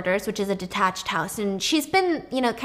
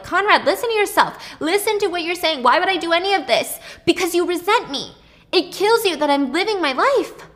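raised, higher heated plea and retort; heated argument; 7 semitones higher, 4 dB louder than usual. Retold or imitated. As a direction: argue back hard. Brothers quarrelling in the street.

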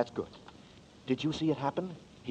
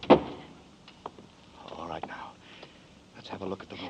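hushed breathy whisper; 6 semitones lower, much slower than usual. Acted. Danny sneaks into the house, whispering. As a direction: whisper so nobody hears.

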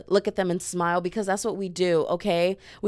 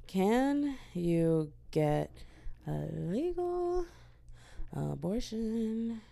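very slow, soft, stretched words; absent-minded, halting and hushed; typing aloud; 7 dB quieter, much slower than usual. Real, not acted. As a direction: draw each word out as you type it. Reads search aloud while typing.

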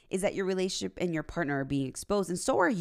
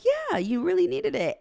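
much higher, teasingly sweet reply; mock innocent; 5 dB louder than usual. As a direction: keep the prank going with a high innocent voice. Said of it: "And I'm like".

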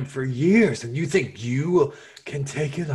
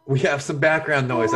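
ordinary talk, nothing marked

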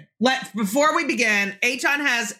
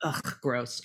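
disgusted; 11 semitones lower, 11 dB quieter than usual. Real, not acted. Host reacts to almond milk.

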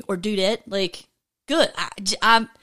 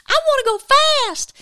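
squealed way up high; excited; 4 dB louder, faster than usual. Real, not acted. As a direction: burst out with pure thrill. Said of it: "I'm just excited".

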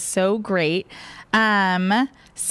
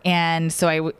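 ordinary talk

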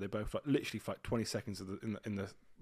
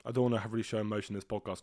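ordinary delivery

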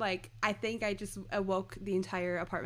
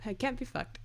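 softer, slightly raised throwaway line; imitated shrug; 4 semitones higher, 5 dB quieter than usual. Fan imitating Laszlo shrugging, soft and dismissive. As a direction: say it with a verbal shrug, softly. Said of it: "like, shrug motion".

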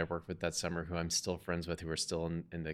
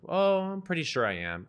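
breezy high reply; 10 semitones higher, 6 dB louder than usual. Retold or imitated.

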